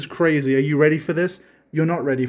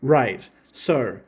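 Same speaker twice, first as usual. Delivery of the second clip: ordinary talk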